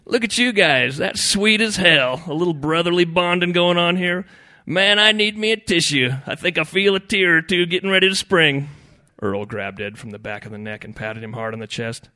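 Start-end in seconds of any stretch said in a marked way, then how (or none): 0.1-8.7 loud, high, mocking gush; sarcastic. A narrator's reading. Fishing buddy mocks the brothers' bonding, sarcastic. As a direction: lay on the fake sentiment thick. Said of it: "commenting sarcastically".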